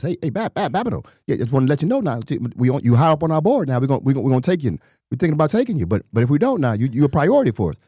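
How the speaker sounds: high-pitched, fast-talking coach voice; Coach Ray Bob impression. Coach phoning an undrafted player, high-pitched impression. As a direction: imitate a coach's excited, high, stumbling patter. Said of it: "he kinda talked like this".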